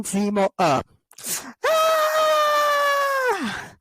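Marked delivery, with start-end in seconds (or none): 1.7-3.7 high shouted yelp of pain; pained scream. Retold or imitated. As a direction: cry out under the blows. Zemo being beaten up.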